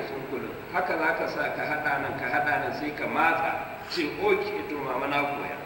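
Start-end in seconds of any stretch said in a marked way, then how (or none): none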